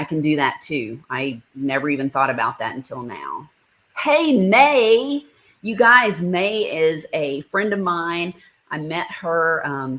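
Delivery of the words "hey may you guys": high, loud, delighted hello; excited greeting. Real, not acted. Streamer spots her friend May in the chat, excited high greeting. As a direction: call out happily to a friend who just arrived.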